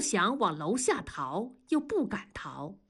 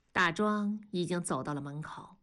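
plain reading voice

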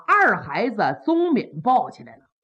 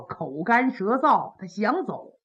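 usual speaking voice

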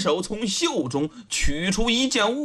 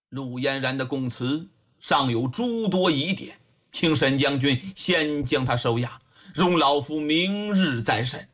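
low, level magistrate giving orders; measured old investigator; authoritative old official; 7 semitones lower than usual. A narrator's reading. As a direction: instruct gravely in a deep voice.

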